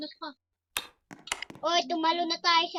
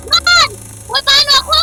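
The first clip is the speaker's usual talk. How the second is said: panicked shout; 7 semitones higher, 12 dB louder than usual. Real, not acted. Left behind, panicking.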